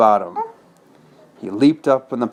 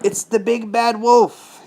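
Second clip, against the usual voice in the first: high, slow piggy cry of alarm; Percy crying out at the wolf; frightened cry; 11 semitones higher, slower than usual. Acted. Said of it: "he cried".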